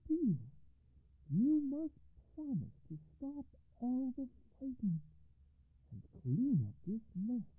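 lightly voiced firm mother; a hint of a stern mother; mother's voice. A narrator's reading. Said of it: "and said".

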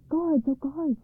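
high, excited princess calling out; eager, high-pitched summons; crying out. A narrator's reading. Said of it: "she cried".

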